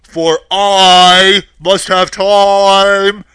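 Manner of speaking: very loud, slow, drawn-out boom; huge wizard voice, stretching every word; booming wizard Oz, stalling. A narrator's reading. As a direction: stall grandly in a thundering voice.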